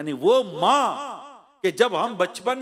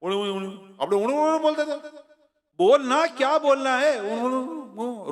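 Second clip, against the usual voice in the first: put-on fast, baby-like babbling sounds; baby babble; 3 semitones higher than usual. Preacher imitating a baby trying to speak, babbling. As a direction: babble like a toddler who cannot talk.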